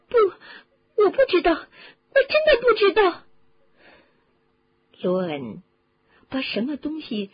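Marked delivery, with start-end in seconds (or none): 0.1-3.2 high, loud, frightened protest; panicked denial. Acted. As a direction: plead ignorance in rising panic. Answers screaming Ron, at a loss.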